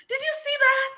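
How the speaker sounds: high-pitched, squealed disbelief; amazed. Real, not acted. Host to viewers after the masterclass, amazed.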